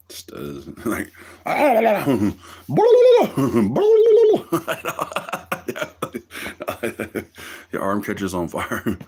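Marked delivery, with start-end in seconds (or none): 1.2-7.7 loud high squealing laughter; a giddy high cackle; long high cackle. Real, not acted. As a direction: burst into a long shrieking laugh.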